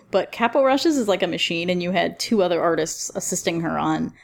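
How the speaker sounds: ordinary talk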